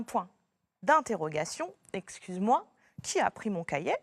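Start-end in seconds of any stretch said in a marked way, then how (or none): none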